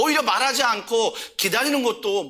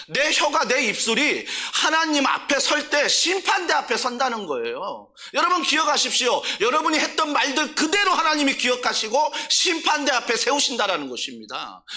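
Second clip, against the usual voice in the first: urgent preaching; 5 semitones higher than usual. Real, not acted. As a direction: drive the warning home to the congregation. Preacher warns of judgment seat.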